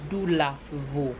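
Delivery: Dulavo drawn out as doo-la-VO; slow correct pronunciation. Shown as a demonstration. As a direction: spell out Dulavo by ear, slowly, "doo-la-vo". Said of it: "It's Dulavo, not De La".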